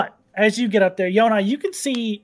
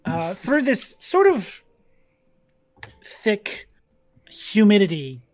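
lightly voiced scene-setting narration; game master narration; 3 semitones lower than usual. A narrator's reading. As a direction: paint the jungle calmly for players.

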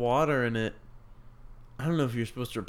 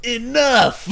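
high, loud yell from the ad; mock shout; 11 semitones higher, 9 dB louder than usual. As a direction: shout like the ad mom. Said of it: "Like, one".

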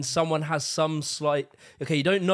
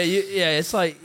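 normal talking voice

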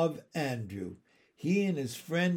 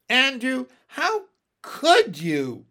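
high, loud, drawn-out wounded moan; 11 semitones higher, 9 dB louder than usual. A narrator's reading. Miss Lark reproaching Andrew, moaning. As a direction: moan it slowly, as one betrayed. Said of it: "moaned Miss Lark".

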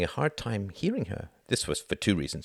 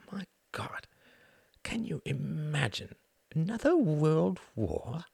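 put-on posh old lord; 10 semitones higher, 5 dB quieter, slower than usual. A narrator's reading. Runciman voiced, horrified aside.

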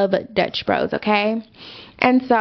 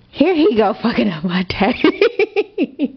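slow, laughing through the words; slower than usual. Real, not acted. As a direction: chuckle as the trouble starts. Laughing as the story turns.

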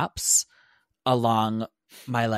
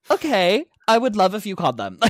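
forced politeness; 7 semitones higher, 6 dB louder than usual. Retold or imitated. Strained politeness to neighbor.

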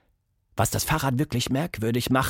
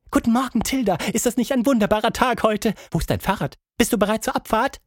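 much higher, quick cheerful chatter; cheerful monkey; 9 semitones higher, faster than usual. Acted. Plappi greeting Tilda, high cheerful chatter. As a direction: greet her bubbling with joy.

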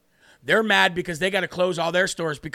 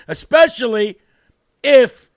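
fired-up; 7 dB louder than usual. Real, not acted. Fired-up rant calling viewers out.

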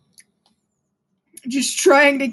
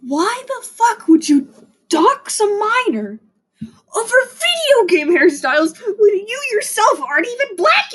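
a high crying rant against the doxxers; outraged cry; 7 semitones higher than usual. Real, not acted. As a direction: plead in outrage.

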